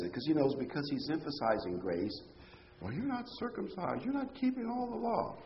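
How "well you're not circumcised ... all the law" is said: higher, softer mock accusation; a light impression of nagging critics; mock accuser. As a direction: voice the petty accusers needling Paul. Voicing Judaizers' accusation.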